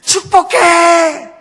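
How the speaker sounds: high, loud, long-drawn holler; child's happy shout. Retold or imitated. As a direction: shout it out to the mountain, stretched. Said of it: "한 번 더 외칩니다". (높고 크게 길게 외치기)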